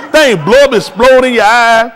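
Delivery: ordinary talk, nothing marked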